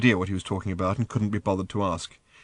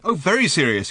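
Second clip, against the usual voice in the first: sarcastic shout; 9 semitones higher, 7 dB louder than usual. A narrator's reading. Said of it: "he shouted".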